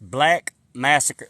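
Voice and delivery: answering a viewer who asked again, very slow; slow repetition. Real, not acted. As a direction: repeat it slowly for a viewer who missed it. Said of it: "What was that again?".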